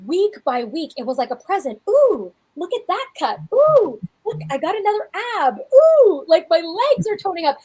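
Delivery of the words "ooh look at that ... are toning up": high, loud delighted ooh over each new muscle; delighted squeals. Retold or imitated. Coach to listeners, gushing over her progress, delighted. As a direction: squeal with joy at every change.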